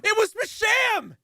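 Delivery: high, slow yell of disbelief; shocked yell. Real, not acted. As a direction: shout it in stunned disbelief. Shocked at surprise witness.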